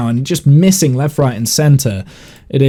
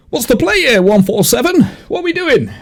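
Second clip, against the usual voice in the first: confused guard; 10 semitones higher, faster than usual. Acted. Guard NPC asks player.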